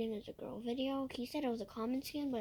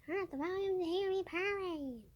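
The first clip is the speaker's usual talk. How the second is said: higher, cutesy cat voice; a light cat voice, higher than usual; purring cat voice; 7 semitones higher than usual. Pretend. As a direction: play the purring kitty, pitched up. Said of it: "I'm purring".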